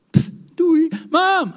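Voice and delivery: high, loud huffy farewell; sulky goodbye. Retold or imitated. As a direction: huff it off like a sulking kid. Child dismisses mother in defiance.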